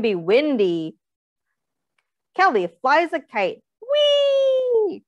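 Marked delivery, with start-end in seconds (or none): 3.8-5.0 put-on high, delighted squeal of a kid; playful whee. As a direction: sing out the joy of a flying kite. Kite flying exclamation in story.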